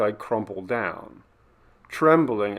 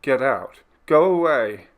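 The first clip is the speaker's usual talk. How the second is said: raised voice, building to a louder plea; frightened cry; 3 semitones higher, 5 dB louder than usual. A narrator's reading. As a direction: cry out to drive it off. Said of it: "I cry into its darkness".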